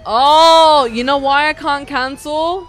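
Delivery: loud, frustrated outburst; loud exasperation. Real, not acted. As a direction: vent loudly to chat. Angry at game mechanics.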